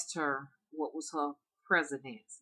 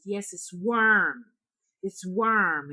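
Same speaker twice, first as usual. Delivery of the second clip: louder and slow; heated insistence; 3 semitones higher, 9 dB louder, much slower than usual. Retold or imitated. Frustrated hunchback woman insists to a confused visitor. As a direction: stamp each word, fed up.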